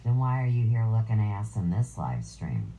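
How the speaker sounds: normal talking voice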